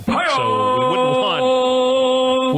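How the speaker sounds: high, loud, very slow drawled "So we wouldn't want"; drawn-out mock warning. Sidekick drags out the punchline, high and slow.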